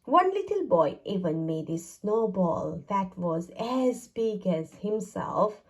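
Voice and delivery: plain reading voice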